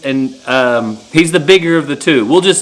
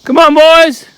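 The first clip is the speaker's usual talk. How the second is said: calling out; 8 semitones higher, 4 dB quieter, slower than usual. Real, not acted. Calling steers across the pasture.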